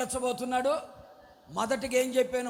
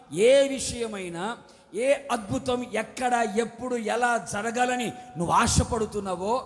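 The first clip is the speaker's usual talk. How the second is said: louder, ringing pulpit declaration; preaching at full force; 4 dB louder than usual. Acted. Preacher blessing his congregation, loud fervent proclamation. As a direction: proclaim each blessing over the crowd.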